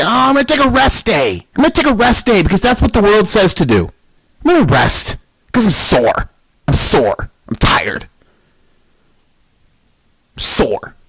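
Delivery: high, whiny mock complaining; imitating a lazy athlete, whining. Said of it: "Did I say".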